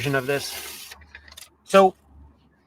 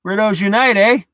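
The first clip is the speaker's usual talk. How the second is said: Canadian eh; 3 semitones higher, 5 dB louder than usual. Shown as a demonstration. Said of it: "Totally Canadian".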